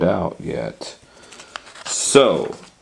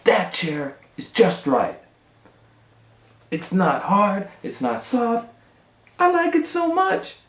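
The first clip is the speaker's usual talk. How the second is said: put-on high, delighted little girl voice; Goldilocks delighted. Girl in the story praising the little chair, high and delighted. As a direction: squeal with delight at the perfect chair. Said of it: "she said".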